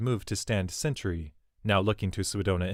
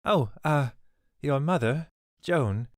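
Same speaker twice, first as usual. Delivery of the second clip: higher, flustered stammer; 7 semitones higher, 3 dB louder, slower than usual. A narrator's reading. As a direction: trip over the words nervously. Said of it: "Swidona stammered".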